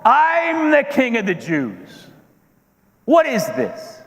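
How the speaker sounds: high-pitched, indignant outburst; losing his temper. Acted. Herod asserting his title against a newborn rival, indignant. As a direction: stretch it out in wounded royal outrage. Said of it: "But I controlled my temper".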